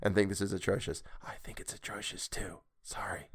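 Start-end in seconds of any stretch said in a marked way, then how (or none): 1.2-3.3 whispered, much softer confession; whispered aside. Real, not acted. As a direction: whisper the admission sheepishly. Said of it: "confessing aside after apology".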